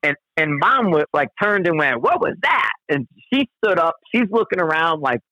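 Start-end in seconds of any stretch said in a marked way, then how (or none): 2.0-2.7 high-pitched mock startled cry; playful imitation of sudden alarm; startled reaction. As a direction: voice a startled bear, pitch jumping way up. Voices startled mother bear's reaction.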